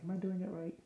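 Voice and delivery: high, doubtful inner voice asking itself; mock self-doubt, lightly voiced; a worried question to herself. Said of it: "It's like".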